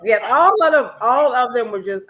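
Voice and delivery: loud, high, gushing yes; host thrilled to share the love of the show; excited agreement. Real, not acted. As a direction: gush with fan excitement.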